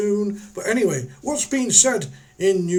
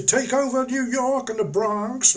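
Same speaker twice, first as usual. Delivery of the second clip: bad American accent; 6 semitones higher than usual. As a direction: attempt at a New York American accent. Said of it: "I can't do the American accent".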